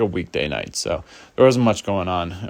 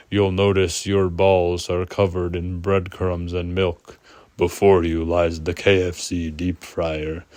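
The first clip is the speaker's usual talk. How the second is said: slow, menacing movie villain voice; Jigsaw; slower than usual. Acted. Hallucinated horror villain taunting the storyteller, slow mock menace. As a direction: put on a slow, ominous horror-villain impression. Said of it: "I'm gonna do my best Jigsaw voice".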